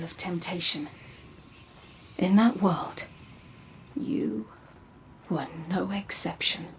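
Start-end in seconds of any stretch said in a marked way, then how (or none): 3.9-6.7 soft whispered reveal. Acted. Guide telling the Guardian of their fallen self, whispered. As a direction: drop to a confiding whisper.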